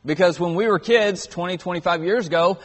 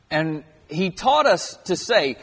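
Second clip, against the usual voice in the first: ordinary delivery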